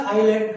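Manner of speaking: आइलैंड said eye-land, no s; teacher showing the silent s. Shown as a demonstration. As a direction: say आइलैंड without any s sound. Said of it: "यहाँ पे एस नहीं बोलना है".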